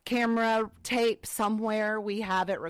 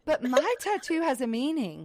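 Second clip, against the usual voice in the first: raised-pitch parody of earnest justification; mocking tattoo owners; 5 semitones higher than usual. Mother quoting anyone who gets tattooed, mocking higher voice. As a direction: mimic the predictable excuse, pitch up. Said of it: "that's the first thing they say".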